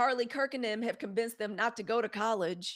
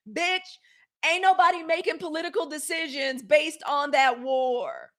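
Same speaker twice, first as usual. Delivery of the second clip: higher, shouted retort; exasperated shout; 6 semitones higher than usual. Real, not acted. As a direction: shout back at her claim. Reacting angrily to the quote.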